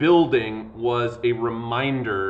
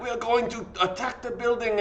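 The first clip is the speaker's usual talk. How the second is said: put-on a half-hearted French accent, raised high; mock French revolutionary, badly done; failed French accent; 10 semitones higher than usual. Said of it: "I can't do a French, uh, accent".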